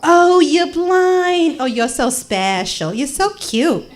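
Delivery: put-on gushing American accent, high drawn-out opening; American exchange student. American student gushing over blind woman, cooing American voice. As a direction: coo in a hammed-up American accent. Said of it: "American exchange chick".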